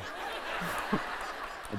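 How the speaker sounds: breathy laugh. Real, not acted. Laughing at own story.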